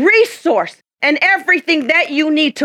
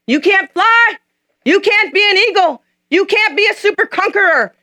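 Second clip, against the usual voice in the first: jeering head talkers; 9 semitones higher than usual. Acted. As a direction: taunt the eaglet down. Head talkers mocking the eaglet.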